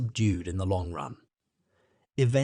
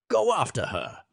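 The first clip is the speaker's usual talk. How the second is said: sharp, higher outburst; 8 semitones higher than usual. A narrator's reading. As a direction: burst out with a sharp edge. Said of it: "he burst out, with a sharp edge in his voice".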